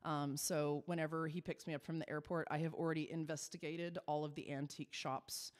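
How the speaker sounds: ordinary delivery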